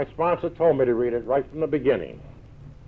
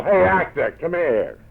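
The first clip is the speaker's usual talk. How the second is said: calling out, higher and louder; yelling across club; 4 semitones higher, 6 dB louder, slower than usual. Retold or imitated. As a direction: hail him across the room. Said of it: "and he'd yell".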